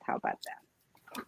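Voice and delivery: normal talking voice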